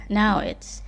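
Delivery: normal talking voice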